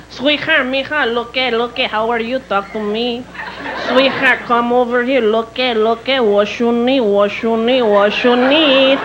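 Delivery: put-on Mexican catcaller accent, words stretched out; mock Mexican accent. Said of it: "Every Mexican guy was hitting on me".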